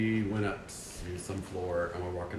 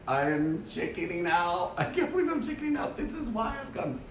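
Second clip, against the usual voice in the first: mock inner panic; 10 semitones higher than usual. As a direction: whine it high like a panicked inner voice. Retold inner panic at chickening out.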